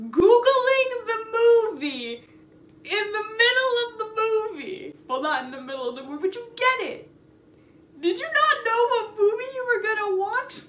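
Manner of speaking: high, loud disbelief; can't believe what they did; incredulous. Real, not acted. Incredulous rant about googling.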